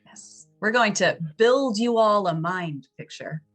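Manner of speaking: normal talking voice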